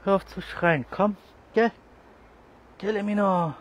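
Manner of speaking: loud, high telling-off; scolding shout. Real, not acted. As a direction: shout at the cat to stop. Scolding a yowling cat. (laut schimpfend, hoch)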